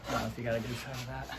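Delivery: ordinary delivery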